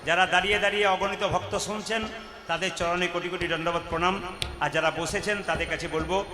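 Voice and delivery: quick soft word to the seated devotees; quick soft aside. Real, not acted. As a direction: rush through it softly to the listeners.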